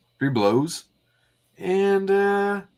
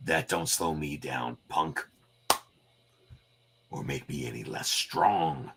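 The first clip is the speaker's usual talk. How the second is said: gruff tough guy; 4 semitones lower, 6 dB quieter than usual. Acted. Wolverine replies.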